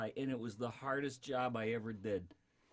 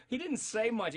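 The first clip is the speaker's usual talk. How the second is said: normal talking voice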